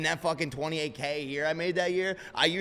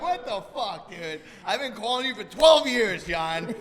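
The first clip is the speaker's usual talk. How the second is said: much higher, louder, laughing put-on indignation; mock outraged client; 8 semitones higher, 6 dB louder, slower than usual. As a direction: ham up the betrayed longtime caller. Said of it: "You're like".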